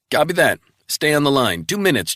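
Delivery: crisp agent reporting in; colleague on the phone. Acted. Travell briskly reporting in to Resnick by phone.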